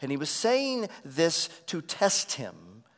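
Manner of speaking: normal talking voice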